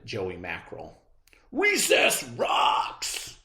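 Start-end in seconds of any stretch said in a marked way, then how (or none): 1.5-3.4 much higher, very slow breathy bellow. A narrator's reading. Clark cheering recess, high and slow. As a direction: drag out the cheer. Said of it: "bellowed Clark the Shark".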